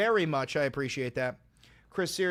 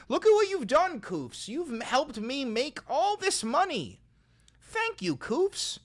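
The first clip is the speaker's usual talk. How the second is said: high, squeaky hammed-up gloat; mock gloating; 9 semitones higher than usual. Streamer gloating at Koofs over donations, high mock voice.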